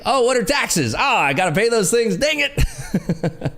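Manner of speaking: lightly voiced naive buyer, laughing at the end; clueless big spender. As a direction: play a reckless spender just realizing taxes exist.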